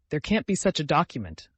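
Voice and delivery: usual speaking voice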